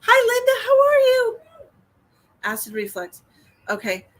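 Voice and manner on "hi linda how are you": bright greeting. Real, not acted. Cheerful high greeting to viewer.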